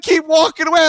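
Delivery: mock-tough send-off; retold taunt. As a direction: imitate the neighbor calling after him. Neighbor calls after him.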